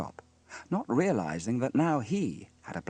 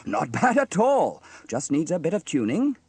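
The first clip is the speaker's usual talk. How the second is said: amused delight; 7 semitones higher, 7 dB louder than usual. A narrator's reading. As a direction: say it pleased, just after laughing. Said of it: "laughed Sam".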